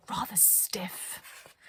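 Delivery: drawn-out, soft whispered word; slow whisper. A narrator's reading. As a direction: stretch it out, stiffly. Narrator savours a word.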